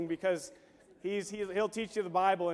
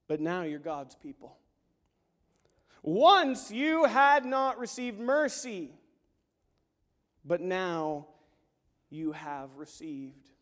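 slow scripture read; 5 dB louder, slower than usual. Real, not acted. Reading scripture with emphasis.

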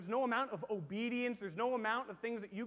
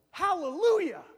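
loud, high burst of Hallelujah; mock joyful shout; 9 semitones higher, 15 dB louder than usual. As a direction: shout it like a happy worshipper. Joyful reaction to a verse.

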